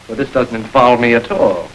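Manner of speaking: ordinary delivery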